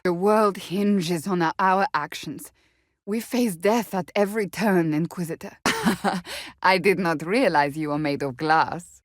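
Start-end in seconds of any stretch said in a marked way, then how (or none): 5.6-6.6 short laugh. Acted. Laugh alone on a line.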